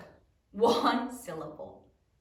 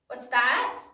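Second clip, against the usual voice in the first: ordinary talk, nothing marked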